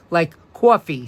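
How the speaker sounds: "coffee" as "cawfee" in a New York accent. Shown as a demonstration. Teacher to class, spelling out New York "cawfee". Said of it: "C A W F E E".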